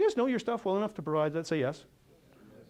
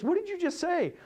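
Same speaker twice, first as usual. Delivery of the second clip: much higher, surprised squeal of a question; friend's amazed question; 13 semitones higher than usual. Retold or imitated. The friend, overhearing the outburst, asks in high astonishment. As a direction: play the stunned friend overhearing. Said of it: "She goes".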